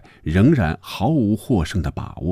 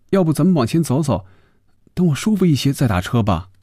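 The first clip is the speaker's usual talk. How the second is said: put-on high-pitched young woman's voice; high, coaxing complaint about feeling unwell; Phoebe, mildly queasy and pleading; 9 semitones higher than usual.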